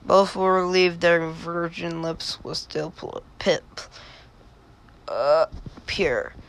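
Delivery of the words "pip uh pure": plain reading voice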